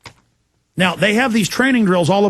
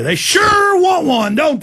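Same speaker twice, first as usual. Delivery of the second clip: high, slow, shouted outburst; sudden shout; 9 semitones higher, much slower than usual. Real, not acted. Host yelling at listeners about the government, slow high shout. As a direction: let it rip, dragging out each word.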